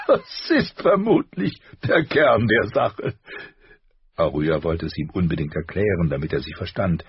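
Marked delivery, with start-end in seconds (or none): 0.0-3.2 slow, amused through a laugh; snort, then speak still laughing. A narrator's reading. Father amused by her answer, snorting a laugh. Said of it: "schnaubte ein Lachen hervor".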